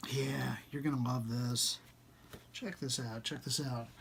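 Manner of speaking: hushed, secretive whisper; soft conspiratorial hush. Real, not acted. Conspiratorial aside about the book.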